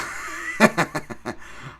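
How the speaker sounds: a short airy laugh; quick rueful chuckle; breathy real laugh. Real, not acted. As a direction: laugh it off under your breath. Laughs at own memories.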